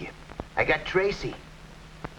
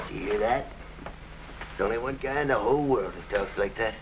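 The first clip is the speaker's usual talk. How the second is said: normal talking voice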